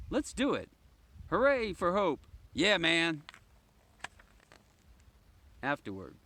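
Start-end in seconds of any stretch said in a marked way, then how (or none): 0.1-3.2 high, loud cheer; rally the group with joy; children cheering, loud and pitched up; cheering kids. Acted.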